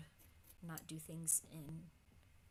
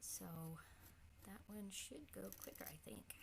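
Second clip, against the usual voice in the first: whispering; 7 dB quieter than usual. Real, not acted. Muttered aside while painting.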